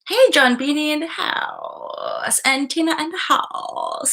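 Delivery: slow, high, drawn-out welcome; sing-song greeting. Real, not acted. Welcoming new viewers to the stream, high and drawn out. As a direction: call out a cheery welcome.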